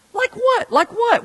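high, defensive husband retort; hammed-up indignant comeback; mock indignation. Husband arguing back to wife.

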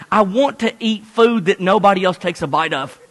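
ordinary talk, nothing marked

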